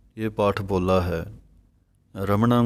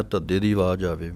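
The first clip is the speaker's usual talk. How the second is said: usual speaking voice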